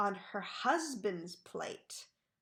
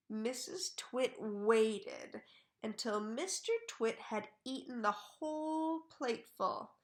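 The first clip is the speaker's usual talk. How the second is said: ordinary delivery, nothing marked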